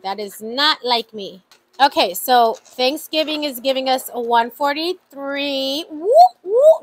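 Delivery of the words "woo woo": much higher, loud happy cheer; excited whoop. Real, not acted. Vlogger cheering her Thanksgiving total, much higher and louder.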